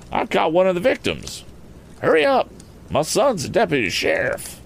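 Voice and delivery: high-pitched rustic alarm; excitable country farmer. Acted. Farmer learning of a crime and calling his son, high excited voice. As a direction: exclaim and order your son to hurry.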